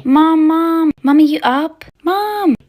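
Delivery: loud, high call through the house; calling for Mom. Acted. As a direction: call out insistently for your mother.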